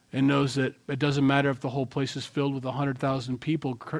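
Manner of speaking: usual speaking voice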